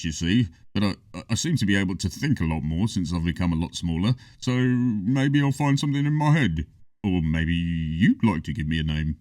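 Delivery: a timid troll, thinking aloud; gentle troll. A narrator's reading. Troll voiced in story.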